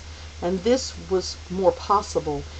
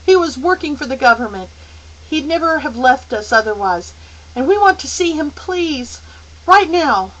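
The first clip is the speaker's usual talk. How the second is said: urgent outburst; 7 semitones higher, 8 dB louder than usual. A narrator's reading.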